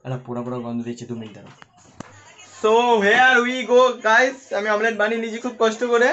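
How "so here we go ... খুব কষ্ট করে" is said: loud, high hype for the reveal; excited. Real, not acted. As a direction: show it off with big excitement. Hyped vlog reveal of the omelette.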